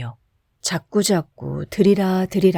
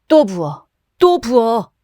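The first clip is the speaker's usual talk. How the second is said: louder, rising call; drunken shout; 3 semitones higher, 5 dB louder, slower than usual. A narrator's reading. Satisfied drunk Kim shouts for another round, loud. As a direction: bellow for more drink. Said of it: "라고 외쳤다".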